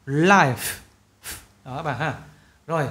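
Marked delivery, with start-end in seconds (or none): 0.1-0.8 "Life" ending on a clear, audible F; final sound kept. Shown as a demonstration. Teacher stressing the ending, clear F in "Life". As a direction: let the F hiss out at the end of "Life". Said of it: "âm cuối này không bao giờ mất được".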